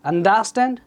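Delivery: Understand with the beat on der; middle-syllable stress. Shown as a demonstration. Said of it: "এটা আপনি কীভাবে বলবেন?". (Understand-এর দ্বিতীয় সিলেবলে জোর)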